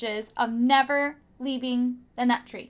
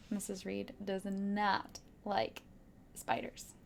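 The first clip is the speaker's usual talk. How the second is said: whispered spiders, voice gone; 6 semitones lower, 13 dB quieter than usual. Real, not acted. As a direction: breathe the word like a secret. Teacher dislikes spiders aside.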